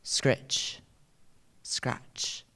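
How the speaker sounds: breathy scraping whisper; whispered razor scratch. Acted. Poem's razor sound words repeated.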